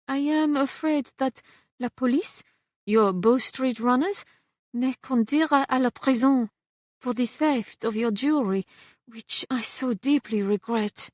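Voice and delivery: lightly voiced French maid, fearful and remorseful; a hint of a French accent, regretful confession.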